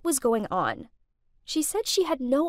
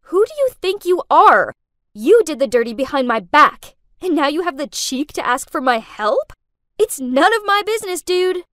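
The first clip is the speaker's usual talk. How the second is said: loud, indignant telling-off; angry shouting with raised pitch; furious yell; 4 semitones higher, 7 dB louder than usual. A narrator's reading. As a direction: lay into him, outraged. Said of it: "Furious, I yelled".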